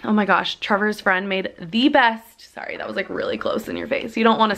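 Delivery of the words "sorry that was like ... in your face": much softer, almost voiceless apology; whispered aside. Real, not acted. Vlogger apologising for a close-up, whispered. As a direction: murmur a quick sorry to the camera.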